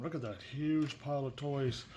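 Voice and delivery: ordinary delivery, nothing marked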